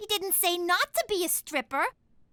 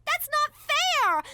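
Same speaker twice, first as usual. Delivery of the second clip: whiny protest; 6 semitones higher, slower than usual. Acted. Daughter whines at refusal.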